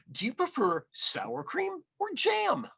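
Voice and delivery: high, sweet hostess asking; sweet granny question. A narrator's reading. Grandmother offering toppings to her guest, sweetly. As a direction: ask brightly like a hostess. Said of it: "Bubba Bryna asked".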